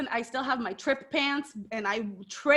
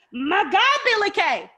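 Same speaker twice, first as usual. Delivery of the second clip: high, loud exclamation; excited exclamation; 8 semitones higher, 5 dB louder than usual. Real, not acted. Exclaiming over wrestler Billy Kay.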